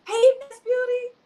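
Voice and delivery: high, loud, bright hello; excited greeting. Real, not acted. Host hails a guest joining the stage, excited and loud. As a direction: greet her like a star arriving.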